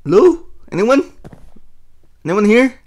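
high, loud questioning calls; calling out. Real, not acted. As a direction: call out to see who's there. Calling out into the empty cave.

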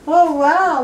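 high, loud wow with a laugh; excited delight. Real, not acted. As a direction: burst out in real delight. Puppies doing something awesome.

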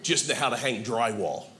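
a hint of a tired father of sons; lightly voiced weary buddy; buddy's low voice. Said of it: "He goes".

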